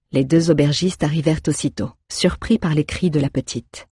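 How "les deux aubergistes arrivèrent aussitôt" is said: plain reading voice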